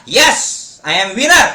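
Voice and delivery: loud, forceful declaration; loud affirmation. Shown as a demonstration. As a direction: proclaim it like a winner. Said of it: "तर जोरात बोला". (जोरात, आत्मविश्वासाने)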